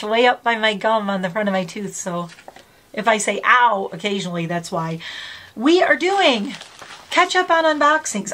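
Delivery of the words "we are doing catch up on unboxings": excited. Real, not acted. Bright announcing of video topic.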